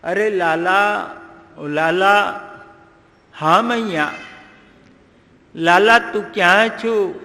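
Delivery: put-on a mother calling her child from afar; mother calling for her little son. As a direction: call out for the missing boy. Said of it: "યશોદાએ બૂમ પાડી".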